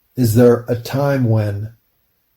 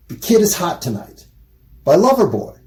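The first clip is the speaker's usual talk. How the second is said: ordinary talk, nothing marked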